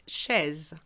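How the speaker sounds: chaise as 'shez' with buzzing final z; sounded Z. Shown as a demonstration. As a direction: close chaise on a voiced z. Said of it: "otherwise you end up saying this word".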